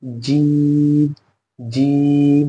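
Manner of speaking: जी stretched out; drawn-out zha. Shown as a demonstration. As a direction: draw out जी like the letter G. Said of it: "बस उसे ही खींच देना है".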